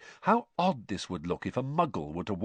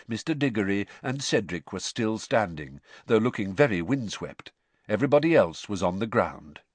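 plain reading voice

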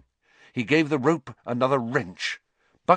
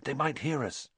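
soft, hushed warning; urgent whisper; 4 semitones higher, 7 dB quieter than usual. Acted. Harry cautioning Hermione, urgent whisper. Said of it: "he whispered to Hermione".

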